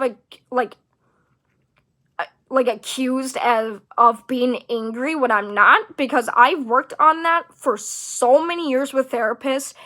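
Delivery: ordinary delivery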